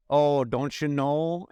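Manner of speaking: put-on Fargo-style Midwestern accent, slow and drawn out; imitated Midwestern accent. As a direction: stretch it like a Fargo local. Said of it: "she had the most amazing accent".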